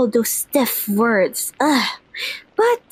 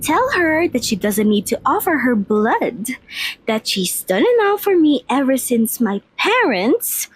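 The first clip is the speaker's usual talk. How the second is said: ordinary talk, nothing marked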